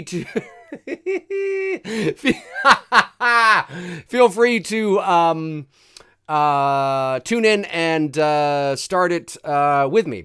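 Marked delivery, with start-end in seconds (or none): 0.0-5.0 laughing. Real, not acted. Laughs mid-sentence.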